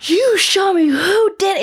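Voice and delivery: slow, loud furious demand; father demanding answers, mock angry; angry dad. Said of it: "He's like".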